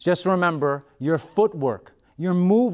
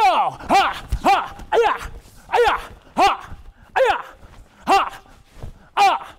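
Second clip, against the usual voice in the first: high, sharp battle cry; kiai shout; 10 semitones higher, slower than usual. Shown as a demonstration. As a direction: snap out a fighting yell. Full-speed sparring shouts.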